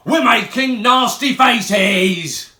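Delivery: put-on Ugly Mug growls at the children; mock growl. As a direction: growl it slowly to sound scary. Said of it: "growled Ugly Mug".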